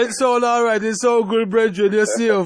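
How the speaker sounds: teasing patois comfort, laughing into the last words; playful Jamaican patois. Retold or imitated. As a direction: ham up a Jamaican elder telling the brethren all is well. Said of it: "them say".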